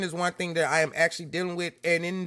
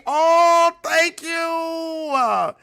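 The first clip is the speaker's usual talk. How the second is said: emotional shout; 7 semitones higher, 6 dB louder, much slower than usual. Real, not acted. Overwhelmed by viewers.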